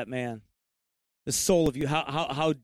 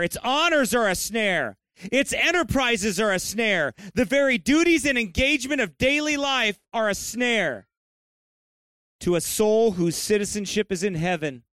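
higher-pitched, insistent preaching cadence; forceful preaching; 7 semitones higher, 4 dB louder than usual. Real, not acted. Preacher warning the congregation of the world's snares, forceful preaching. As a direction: preach it hard, driving each repeated phrase home.

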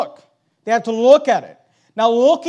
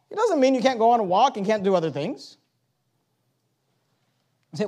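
ordinary talk, nothing marked

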